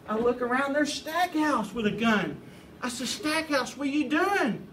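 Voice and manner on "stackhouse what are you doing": high-pitched mock alarm; acted disbelief, pitched up; shocked question. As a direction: call out aghast at a friend, retelling it. Shocked at friend firing a gun.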